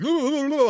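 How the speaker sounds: high mock-excited "ooh ooh ooh"; excited hooting. Dorky friends burst in on the moment, excited hooting. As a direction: hoot high, imitating an overexcited gang. Imitates Dark Order barging in excitedly.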